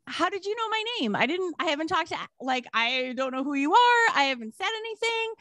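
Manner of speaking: high, hurried mock protest; quick, high-pitched incredulous retelling; retold bafflement. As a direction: imitate a stranger caught off guard. Baffled stranger questions the friend.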